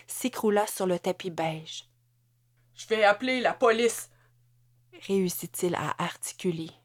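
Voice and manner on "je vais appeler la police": a touch of a cracking voice, mildly raised; broken voice. A narrator's reading. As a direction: force the words out through pain. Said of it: "la voix brisée". (voix brisée, légèrement forcée)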